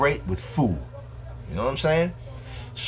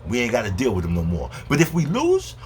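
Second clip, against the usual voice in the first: ordinary talk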